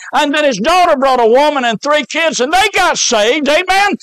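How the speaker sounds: high-pitched, fired-up revival preaching; excited preaching. Real, not acted. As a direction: preach it with rising joy, punching the amens. Preaches excitedly at full force.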